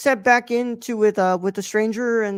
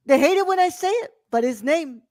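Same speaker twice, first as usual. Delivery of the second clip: higher-pitched politician impression; Donald Trump; 6 semitones higher than usual. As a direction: confide it to a rally crowd, pitched up. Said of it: "say this in the Trump voice".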